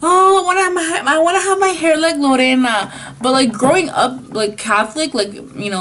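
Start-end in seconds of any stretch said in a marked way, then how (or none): 0.0-2.9 put-on high, pleading little-girl voice; small girl dreaming out loud; kid self wanting to be Lorena. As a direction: play your younger self begging to look like her. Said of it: "I was like".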